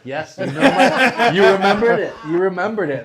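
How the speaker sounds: high, loud, laughing thanks; laughing delight. Retold or imitated. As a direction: gush through a laugh as the order is remembered. Delighted regular remembered drink.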